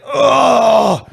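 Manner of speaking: high, loud, hammed-up moan; mock ecstatic cry. Wrestler climaxing in the coffin, mock ecstatic cry. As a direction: blurt it high in mock rapture.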